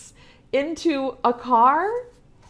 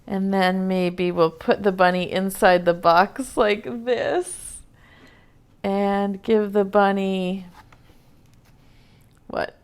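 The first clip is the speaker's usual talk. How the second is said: usual speaking voice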